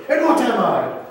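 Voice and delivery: acted indignation. Princess challenging her captor, acted indignation. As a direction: demand an answer as the offended princess.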